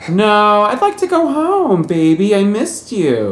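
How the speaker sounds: high, syrupy put-on voice, slowing on the last words; mock seductive Z. Podcaster voicing Z coaxing him home, high and cooing. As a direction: coo it up high, dragging out I missed you.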